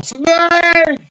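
very high loud yell; high mock alarm shout; prank yell. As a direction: shout it to scare him. Prank yell at hiding friend.

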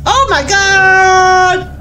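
drawn-out, alarmed cry; startled cry. Real, not acted. Player reacting to a horror game scare, startled cry. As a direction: stretch the last word out loudly in shock.

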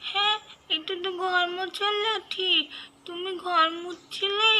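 put-on small scared kid crying out for her mother; Mahi. Child calling out to her missing mother after a nightmare.

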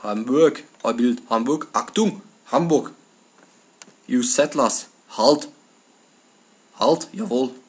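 punchy, loud mock German commands; staccato parody of Brits talking German; mock German accent. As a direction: bark short orders in a comic German accent. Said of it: "they have a German accent".

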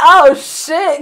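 mock surprise at the naked neighbor; neighbor shocked. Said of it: "looking at me like".

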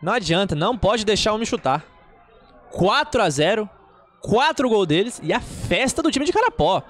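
high, loud goal scream; explosive goal commentary. Acted. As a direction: erupt into the goal call. Goal celebration call.

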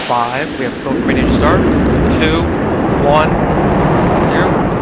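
countdown building to a loud liftoff; rising excitement into liftoff; excited launch call. Real, not acted.

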